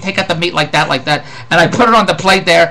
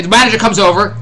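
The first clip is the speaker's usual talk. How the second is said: ordinary talk, nothing marked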